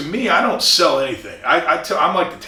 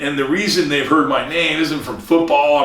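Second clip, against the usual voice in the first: normal talking voice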